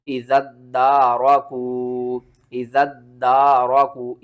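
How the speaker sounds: इज़्ज़त दारकू slowly, first word locked into a doubled d; measured इज़्ज़त दारकू with long aa and oo; correct joined reading. Shown as a demonstration. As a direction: join इज़्ज़त hard into दारकू and stretch the long vowels. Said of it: "ज़ाल को हमने दाल के साथ मिलाना है".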